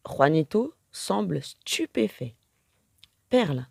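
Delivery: ordinary delivery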